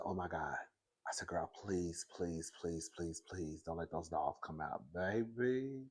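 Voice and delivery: very soft, low, whispery begging; hushed pleading. Retold or imitated. Scared neighbour begging that the dogs stay inside, hushed pleading. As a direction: plead under the breath so nobody hears.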